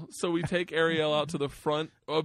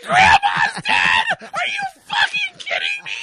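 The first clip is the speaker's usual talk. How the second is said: much higher, louder, slow anguished shout; child's wailing cry; 12 dB louder, slower than usual. Retold or imitated. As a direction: howl it in disbelief. Daughter wailing in disbelief.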